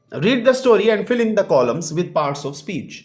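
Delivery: slow, careful classroom reading; slow textbook read-aloud. Acted. Teacher reading an exercise instruction to the class, slowly. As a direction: read each word clearly for the pupils.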